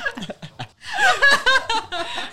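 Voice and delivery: long, high laughing fit; high laughter. Real, not acted. Recalling friend speechless, a long high laugh.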